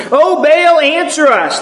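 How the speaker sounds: frantic prophets crying to their god; crying out to Baal. Acted. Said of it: "they cried".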